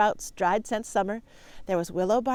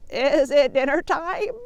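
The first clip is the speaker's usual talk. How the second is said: slow, a bit higher bleating goat call; 5 semitones higher, 6 dB louder, slower than usual. Acted. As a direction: drag it out like a goat calling down. Mister Goat calls.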